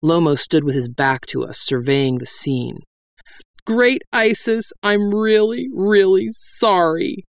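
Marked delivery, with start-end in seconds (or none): 3.7-7.2 higher, slowing wail. A narrator's reading. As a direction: wail your apology. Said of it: "the bandit ... wailed".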